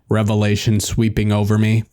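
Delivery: ordinary delivery, nothing marked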